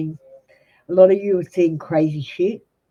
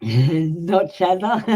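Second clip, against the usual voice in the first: laughing; much slower than usual. Real, not acted. Laughs at the nickname.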